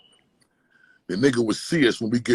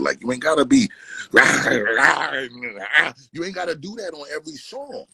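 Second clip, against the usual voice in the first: mock hype rah rah; 7 semitones higher than usual. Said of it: "just tone it down a little bit".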